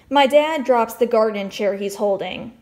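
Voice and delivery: plain reading voice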